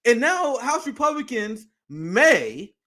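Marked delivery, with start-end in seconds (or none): 1.9-2.7 "may" drawn out slowly with weight; stress on "may". Real, not acted. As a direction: lean hard on "may" and stretch it. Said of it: "emphasis on may".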